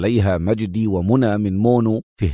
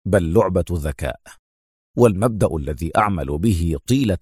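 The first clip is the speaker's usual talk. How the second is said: lower, steady, unhurried; calm answer; 6 semitones lower than usual. A narrator's reading. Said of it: "أجابه أدهم في هدوء".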